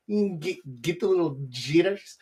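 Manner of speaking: usual speaking voice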